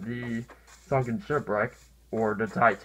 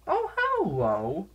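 silly greeting voice; 8 semitones higher, slower than usual. Pretend. Greeting mob in odd voice.

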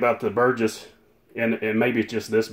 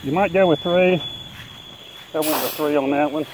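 through a respirator; 4 semitones higher, 5 dB quieter than usual. Real, not acted. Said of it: "through this respirator".

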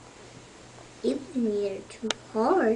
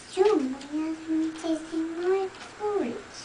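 middle-sized bear voice; 6 semitones higher than usual. Acted. Reading to a child, the middle-sized bear complains mildly. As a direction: say it a bit higher, not booming. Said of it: "said the middle-sized bear in a middle-sized voice".

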